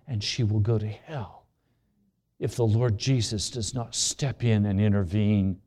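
low, hushed pleading prayer to God. Retold or imitated. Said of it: "I've been praying all this week".

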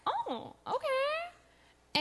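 high, drawn-out, tentative acceptance; intrigued reaction. Retold or imitated. Recalling her reaction to a friend's interest, intrigued. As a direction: let it float up high and slow.

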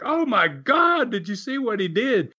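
high, startled cry of disbelief, half-acted; mock horror-movie shriek; retold shocked scream. Control room crew reacting to the head-smash, high startled cry. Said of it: "they screamed".

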